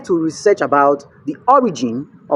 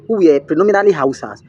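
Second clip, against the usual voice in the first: ordinary talk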